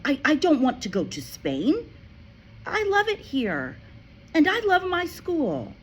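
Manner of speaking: put-on high, urgent child's voice; a child begging to stay; pleading girl. A narrator's reading. Matilda begs her father not to leave, high and pleading. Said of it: "Matilda cried".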